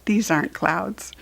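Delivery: plain reading voice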